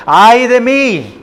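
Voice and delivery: slow, high-pitched exclamation of woe; forceful lament. Shown as a demonstration. Preacher showing how Micah exclaims it, forceful lament. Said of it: "la fuerza en cual él dice estas palabras".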